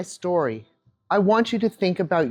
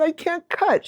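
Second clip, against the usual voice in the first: put-on much higher small child's voice; little girl; 9 semitones higher, slower than usual. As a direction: play the crying nine-year-old. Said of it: "And I said".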